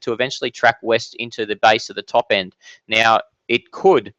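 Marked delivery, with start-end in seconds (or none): 2.9-4.1 could said slow and leaned on, falling; slow down and weigh the word could; stress on could. Shown as a demonstration. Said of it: "I do stress the word could".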